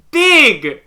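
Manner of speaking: loud, high shout; big high-pitched burst; excited exclamation. Acted. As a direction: blurt it out at full volume. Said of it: "she points at you and goes".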